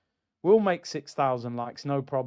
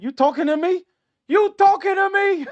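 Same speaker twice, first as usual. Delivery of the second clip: high, loud and building higher; hammed-up confrontation, pushing harder on the repeat; mock tough-guy challenge; 14 semitones higher, 6 dB louder than usual. Acted movie-style tough-guy challenge.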